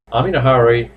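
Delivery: normal talking voice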